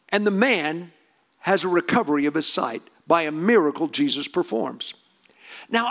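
normal talking voice

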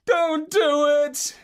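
high, slow pleading. Acted. Dreaming Marlowe begs the parrot, high pleading. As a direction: beg him not to do it, up high. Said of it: "I pleaded".

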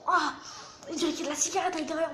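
ordinary talk, nothing marked

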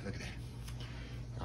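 breathy hushed whisper. Real, not acted. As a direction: whisper it in awe. Savoring food, hushed.

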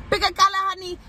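high, sing-song nail tech voice; nail salon worker impression. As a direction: ask like the salon lady. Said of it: "when they be like".

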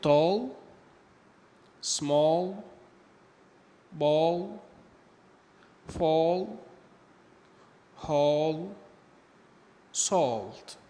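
"tall, small, ball, fall, hall, salt" with the A as an O-like vowel; A pronounced like O. Shown as a demonstration. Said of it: "حرف ال A بينطق شبيه لحرف ال O". (نطق حرف A في "tall, small, ball, fall, hall, salt" زي حرف O)

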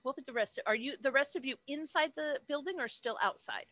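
usual speaking voice

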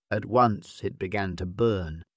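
ordinary delivery

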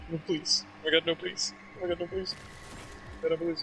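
soft, quiet desperate pleading; terrified horror victim. Real, not acted. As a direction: beg for your life under your breath. Being attacked, pleading repeatedly.

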